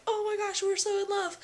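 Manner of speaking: very high, doting squeal; gushing in love. Retold or imitated. Parents melting over their baby's ultrasound, high doting gush. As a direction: melt over the baby picture.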